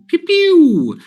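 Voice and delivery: high, loud "pew pew" noise; imitated fun sound. Imitating the leader's funny office noises, playful sound effect. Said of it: "Gordon always has these fun sounds".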